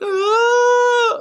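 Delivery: a high, loud wailing cry; women wailing along in a group cry, a loud high wail; mock wail. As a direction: let out one big group-cry wail. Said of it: "group cry with me when they're like".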